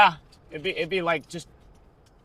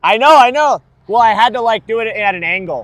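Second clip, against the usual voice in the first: loud exclaiming; 7 semitones higher, 13 dB louder than usual. Real, not acted. Defending himself loudly to chat.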